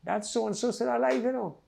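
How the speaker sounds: high, lightly voiced man; imitating fella. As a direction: mimic the man noticing, pitched high. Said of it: "I hear a fella say".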